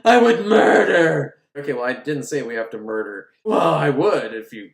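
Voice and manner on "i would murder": screaming high, very loud; manic shout. Retold or imitated. Possessed heroine answering the villain, manic high scream. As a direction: scream it with wild hunger.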